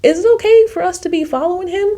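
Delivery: high, quick worried whisper-talk to her friend; anxious squeak, rushing the question; nervous high aside. Retold or imitated. To her friend in the dark alley, high and worried.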